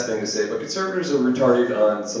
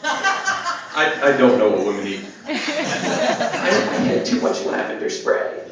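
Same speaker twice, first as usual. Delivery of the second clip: mock woman yelling back; 10 semitones higher than usual. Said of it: "screaming back".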